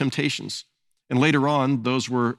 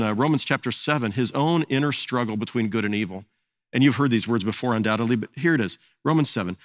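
ordinary talk

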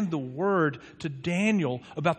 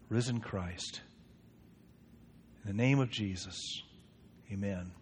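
prayer. Acted. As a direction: pray it humbly before God, drawing out key words. Pastor leads congregation in prayer.

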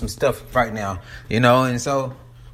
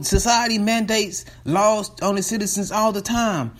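high put-on spokeswoman voice, pitched up; spokesperson voice; 7 semitones higher than usual. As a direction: sell the idea like a corporate spokeswoman. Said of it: "she was talking about".

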